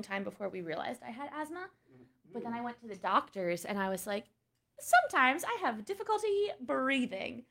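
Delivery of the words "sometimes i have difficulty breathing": high, slightly mock plaintive voice; retold patient complaint. Kid patient telling the doctor about breathing trouble, mock complaint. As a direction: pitch it up like a young patient explaining.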